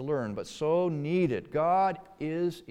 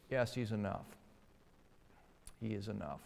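hushed, low, heartfelt yes; tear-stained, heartfelt; 4 semitones lower, 11 dB quieter, faster than usual. Acted. Preacher voices David's answer to suffering, hushed and heartfelt. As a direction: answer softly, as through tears, sinking lower. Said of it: "This is a tear-stained, heartfelt".

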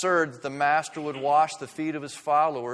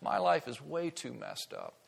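soft, pitched-up excuse, fading; hushed, self-deprecating refusal; ashamed refusal; 3 semitones higher, 6 dB quieter than usual. Acted. Voicing someone refusing church.

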